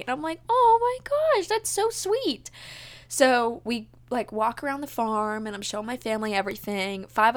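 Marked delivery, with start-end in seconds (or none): 0.5-2.5 retold touched gushing. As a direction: gush sweetly high, stretching the end.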